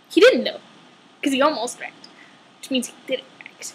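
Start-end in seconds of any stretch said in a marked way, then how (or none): 2.6-3.6 soft unvoiced punchline; whispered aside. Real, not acted. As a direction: breathe it as a sly joke.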